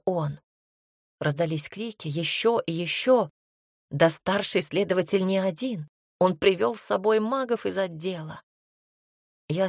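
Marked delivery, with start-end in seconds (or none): none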